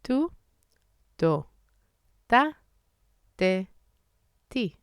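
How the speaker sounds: tu to ta te ti with little air on t; t drill. Shown as a demonstration. Teacher drilling Hebrew t syllables. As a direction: keep the t soft with no puff. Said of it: "Let's do it together again".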